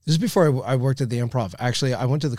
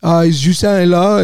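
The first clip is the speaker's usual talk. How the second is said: put-on slow, louder Haitian French-accented ask; drawing out the question in a Haitian accent; Haitian friend; 5 semitones higher, 6 dB louder than usual. Haitian friend asking for Justin, slow Haitian accent. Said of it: "I had a friend who was Haitian".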